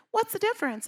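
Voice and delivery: put-on very high, small, wide-eyed young voice; squeaky mock naive student; little twenty-year-old voice. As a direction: ask it like a naive kid. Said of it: "my little, like, twenty-year-old voice going".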